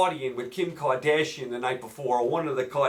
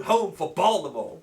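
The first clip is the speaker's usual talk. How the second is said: normal talking voice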